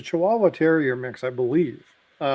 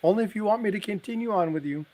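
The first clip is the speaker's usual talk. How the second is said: voicing his wife; 7 semitones higher than usual. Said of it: "She goes".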